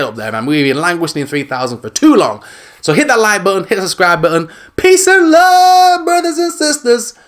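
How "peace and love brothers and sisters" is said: high, very slow preacher-like blessing; slowed mock-reverent sign-off; drawn-out sign-off. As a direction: stretch out the farewell. Channel sign-off.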